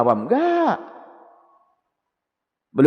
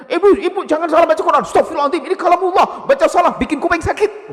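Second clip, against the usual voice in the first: high, loud scolding with laughs; mock harsh ustadz; 6 dB louder than usual. Harsh ustadz scolding an elderly woman, high loud mock reproach. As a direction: ham up a pompous teacher reproaching an old lady.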